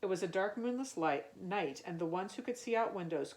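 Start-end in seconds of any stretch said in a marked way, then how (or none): none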